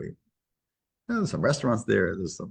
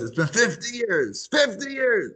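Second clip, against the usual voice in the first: high, drawn-out exclamation, building higher; bursting excitement; 12 semitones higher, slower than usual. Retold or imitated. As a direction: cry it out high, stretching each word. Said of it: "what's with this energy?".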